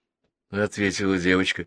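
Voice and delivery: ordinary delivery, nothing marked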